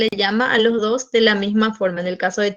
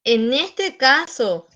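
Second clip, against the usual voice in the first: ordinary talk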